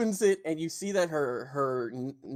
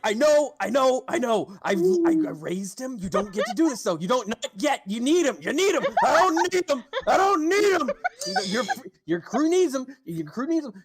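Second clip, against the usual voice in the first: higher, louder back-and-forth pleading and raging; heated argument; 8 semitones higher, 4 dB louder than usual. Acted. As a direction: escalate the quarrel line by line. GM voices Yolanda and Garum arguing.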